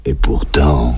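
low campy drag voice; crazy deep voice. Acted. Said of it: "Cette voix de fou".

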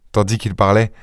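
plain reading voice

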